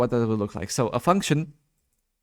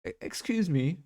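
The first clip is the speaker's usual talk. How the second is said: higher, softer British attempt; British accent; 6 semitones higher, 6 dB quieter than usual. Shown as a demonstration. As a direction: try British, pitched up and softer. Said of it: "can you do a British one".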